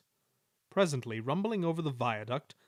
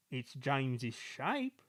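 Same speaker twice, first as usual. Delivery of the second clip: softer and slow, pondering; thoughtful; 5 dB quieter, slower than usual. Acted. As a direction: muse over the dirty shape. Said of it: "said Toby thoughtfully".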